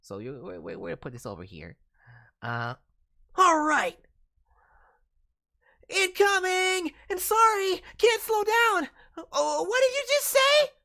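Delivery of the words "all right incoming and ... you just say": high, loud, frantic yell; brash rushing character. Acted. Character speeding past rude pedestrians, yelling. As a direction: shout a warning while speeding past. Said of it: "apologetic and shy to angry and brash".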